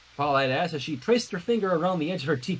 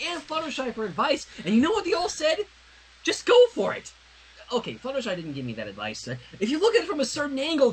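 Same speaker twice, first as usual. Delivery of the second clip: high, rushed, frantic confession; a nervous princess blurting it all out; flustered Twilight; 8 semitones higher than usual. Acted. Twilight blurts out her panic to Sunset, high and frantic.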